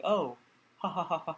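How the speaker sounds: evenly spaced, deliberate ha-ha-ha; imitating an android's forced laughter; forced fake laugh. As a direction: fake a creepy, mechanical laugh. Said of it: "forced fake laughter".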